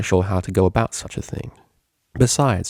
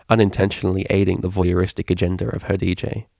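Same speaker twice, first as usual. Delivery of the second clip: ordinary delivery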